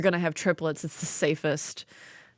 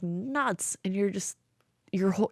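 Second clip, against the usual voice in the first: ordinary delivery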